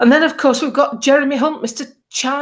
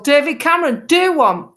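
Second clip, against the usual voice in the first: cheeky dismissal; 6 semitones higher, 3 dB louder than usual. Retold or imitated. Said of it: "I did this do one thing".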